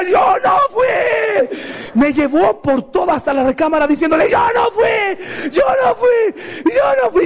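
high wailing shouted denial; crying denial. Retold or imitated. Boy dragged to the bedroom wails he didn't do it. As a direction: sob and yell your innocence while being dragged. Ya le decía.

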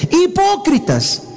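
high, drawn-out rebuke of hypocrites; stretched, soaring Hipócritas; rebuking cry. Acted. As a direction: cry out the word like a prophet's reproach. Jesus rebukes the crowd.

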